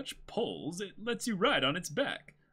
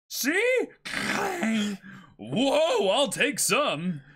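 put-on much higher and louder boyish yelling; excited Luffy; 8 semitones higher, 6 dB louder, much slower than usual. Luffy shows off gold to the shopkeeper, loud and high. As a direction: crow over the treasure like a kid.

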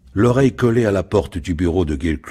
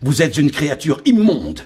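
higher, a bit louder disgust; outraged cry; 10 semitones higher, 4 dB louder than usual. Acted. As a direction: spit out your disgust. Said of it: "s'écria le capitaine".

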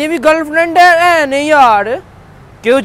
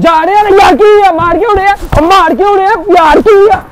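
high, loud, wailing complaint; upset, loud protest; 3 semitones higher, 5 dB louder than usual. Real, not acted. Waiter leaving while being hit, upset loud protest. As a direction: plead loudly, close to crying.

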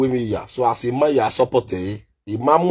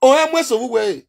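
mock commenter voice; 7 semitones higher, much faster than usual. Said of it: "Àbí commenters náà".

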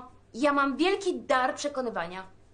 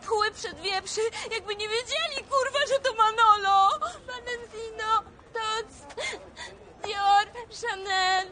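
indignant rant; 7 semitones higher than usual. Acted. Indignant rant about ignorant buyers.

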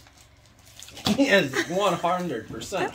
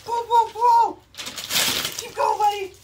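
high, loud pull pull pull; excited cheering; 6 dB louder, slower than usual. Real, not acted. Cheering baby to pull paper.

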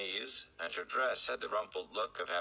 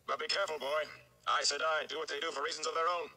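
put-on higher, quicker old storyteller's warning; raised, hurrying gleeman's voice; Gleeman, urgent warning; 8 semitones higher, faster than usual. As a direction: call a caution after the departing boy.

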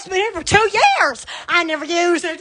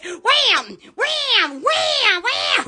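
imitated slam; 5 semitones higher, much slower than usual. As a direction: sound of a table slamming, rising higher each time. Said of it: "it would go like this".

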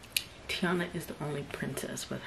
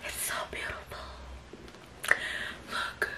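breathy, overcome whisper; hushed and overwhelmed; awed whisper; 4 dB quieter than usual. Real, not acted. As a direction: whisper it in real awe. Overwhelmed by the new bag.

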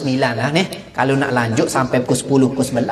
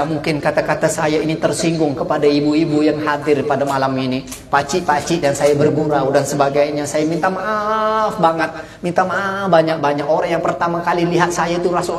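ordinary talk, nothing marked

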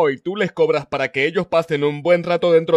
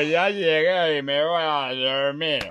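put-on slow, low drunken slur; 4 semitones lower, 3 dB quieter, slower than usual. Drunk husband coming home late to his wife, slurring. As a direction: slur it heavily, half asleep.

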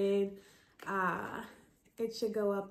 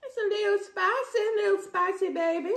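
high, loud teasing sing-song; sing-song spicy; 10 semitones higher, 7 dB louder than usual. Real, not acted. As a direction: savor the heat playfully. Reacting to spicy food.

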